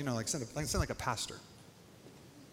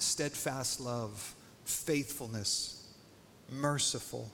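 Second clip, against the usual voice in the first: slow emphasis; 3 semitones lower, much slower than usual. Acted. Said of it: "slowed emphasis on attributes".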